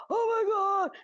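high, loud, frantic yelp; panicked old man. Retold or imitated. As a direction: squeal it like a flustered old man who just got bumped. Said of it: "he was going spastic".

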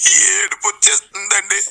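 high-pitched fake wailing; hammed-up squeal of a weeping devotee; mock crying. Devotee asked why she weeps, mock crying. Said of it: "ఎందుకు తల్లి?".

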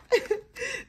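sudden high-pitched laugh. Real, not acted. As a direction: burst out laughing. Laughs at own joke.

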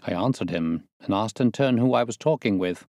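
plain reading voice

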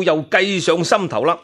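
plain reading voice

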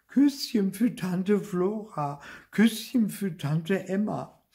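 slow, high sing-song mimicry; mocking imitation. Boy mimics having to kiss each aunt, mocking. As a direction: parody the dutiful kiss, drawn out. Mimics the obligatory kissing routine.

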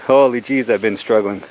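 ordinary delivery

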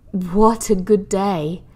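slow, heavy, overfed sigh; sluggish. Acted. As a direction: drag it out, stuffed full. Hetty now sluggish and full.

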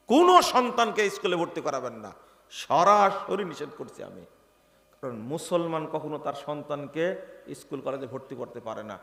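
ordinary talk, nothing marked

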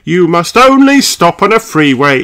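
high, emphatic officer voice; Police Rabbit. Acted. Police Rabbit tells off drivers stopped on freeway, emphatic. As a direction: lay down the rule in a high voice.

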